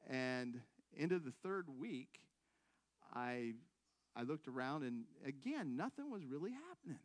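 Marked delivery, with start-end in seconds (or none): none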